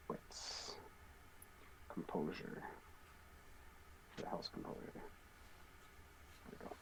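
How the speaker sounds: barely voiced, very soft mumble; muttering to self. Real, not acted. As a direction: mutter while hunting down a list. Searching the sheet for the stat.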